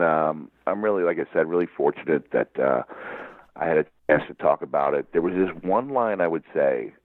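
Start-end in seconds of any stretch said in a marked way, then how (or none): none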